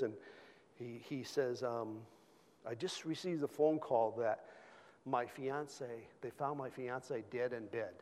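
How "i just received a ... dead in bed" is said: ordinary delivery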